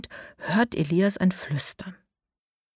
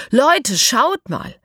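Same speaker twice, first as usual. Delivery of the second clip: excited call; 6 semitones higher, 5 dB louder, much slower than usual. A narrator's reading. Elias shows the glowing note.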